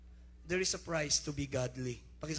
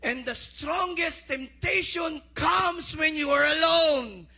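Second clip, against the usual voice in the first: slow, much higher and louder shout; preaching shout; 10 semitones higher, 12 dB louder, much slower than usual. Real, not acted. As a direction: hit the climax full force, slowly. (sigaw ng pangangaral)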